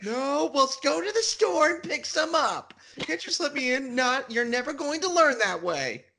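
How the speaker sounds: high nagging mom voice. Pretend. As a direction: scold like a fed-up mother. Acted skit, nagging mom voice.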